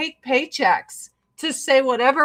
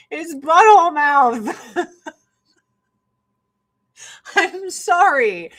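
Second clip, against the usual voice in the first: laughing; 6 semitones higher, 6 dB louder than usual. Real, not acted. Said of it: "laughing at the resemblance".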